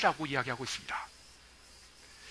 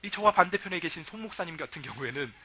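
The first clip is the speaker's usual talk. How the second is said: ordinary delivery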